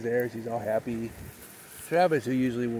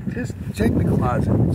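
urgent call; 5 semitones higher, 8 dB louder than usual. Retold or imitated. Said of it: "And I'm like".

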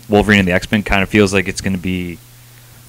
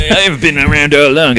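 mock hipster boast; 6 semitones higher, 5 dB louder than usual. Mock hipster claim, loud.

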